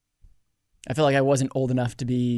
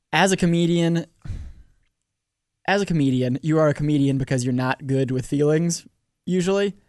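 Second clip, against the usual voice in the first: usual speaking voice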